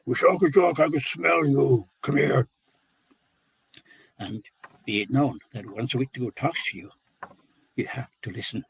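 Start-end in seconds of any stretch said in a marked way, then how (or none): 0.1-2.5 loud booming call from the hidden monster; hollering. A narrator's reading. Cannibal monster calling loudly to the hiding Wisaki Jack. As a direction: roar out his name, slow and menacing. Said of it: "and he hollers".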